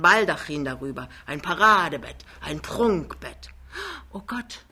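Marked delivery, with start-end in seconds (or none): 4.1-4.7 soft, high gasp of awe; hushed amazement; awed exclamation. A narrator's reading. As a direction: gasp it quietly, overwhelmed. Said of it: "rief Lämmchen".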